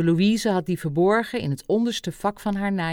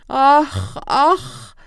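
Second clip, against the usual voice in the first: loud, high sighing lament; moved old doctor's exclamation; tearful lament; 6 semitones higher, 6 dB louder than usual. A narrator's reading. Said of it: "veegde zijn tranen weg".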